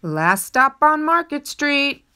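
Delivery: loud, high call down the bus. Acted. Bus driver calling the last stop to passengers, loud and high. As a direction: announce the stop to the whole bus. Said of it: "Mister Dennis called".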